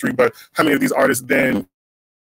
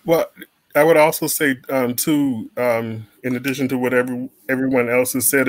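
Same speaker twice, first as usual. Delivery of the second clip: normal talking voice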